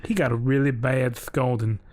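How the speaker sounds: ordinary talk, nothing marked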